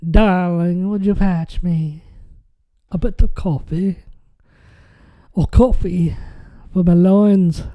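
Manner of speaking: drawn-out mock Southern that slips about; wandering Southern accent. Joker asking darling for coffee, slipping Southern accent. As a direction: ham up a Southern drawl that drifts off target. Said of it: "I'm just doing different accents now".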